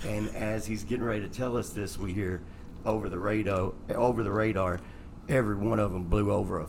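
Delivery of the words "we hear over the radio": ordinary talk, nothing marked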